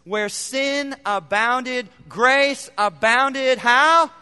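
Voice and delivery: slow, loud drawn-out build; preaching emphasis. Acted. Preacher leading the congregation to the answer, slow and building. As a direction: stretch each word to build the question. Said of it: "preacher builds to the answer".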